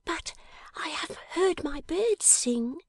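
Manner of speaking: high, hushed and dreamy; high breathy girl's whisper; tender whispering girl. Acted. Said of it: "cried the little creature".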